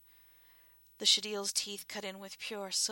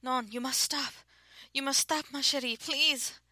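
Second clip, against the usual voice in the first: high, loud frantic begging; rising, loud terrified plea; panicked pleading; 7 semitones higher, 9 dB louder than usual. A narrator's reading. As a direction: beg her to stop, pitch climbing in alarm. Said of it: "warning her in a panic".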